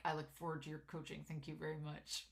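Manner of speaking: low, soft grumbled thanks; grudging politeness. Retold or imitated. Grandson thanking his coach under grandma's eye, grudgingly polite. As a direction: mimic a reluctant, muted thank-you. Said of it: "don't be grumbly".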